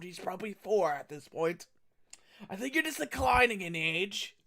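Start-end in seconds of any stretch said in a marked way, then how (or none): none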